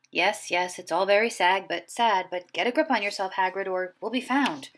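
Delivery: usual speaking voice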